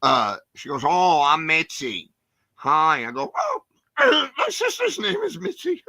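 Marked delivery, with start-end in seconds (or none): 0.8-3.1 louder, very slow, sweet small-woman voice; a tiny kind lady greeting, stretched out; sweet little lady. Retold or imitated. Lady introduces herself. 3.4-5.8 much higher, strangled, nervous blurt; flustered squeak. Retold or imitated. Nervous kid blurts a reply.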